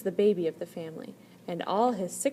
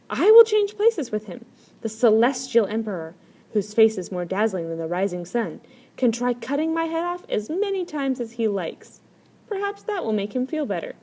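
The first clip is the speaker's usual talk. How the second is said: bold fourth brother; 3 semitones higher, 7 dB louder than usual. A narrator's reading. Fourth brother tells his brothers he will swap places, bold. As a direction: offer your head with a grin in the voice.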